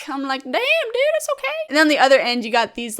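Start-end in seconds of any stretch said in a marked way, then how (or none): 0.4-1.5 taken aback. Retold or imitated. Calming a panicking staff member.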